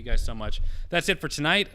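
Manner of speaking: booming end-of-stream thank-you; loud enough to hurt the viewers' ears; loud sign-off. Real, not acted. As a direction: belt the goodbye at the mic. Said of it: "that's gonna blow out everybody's eardrums".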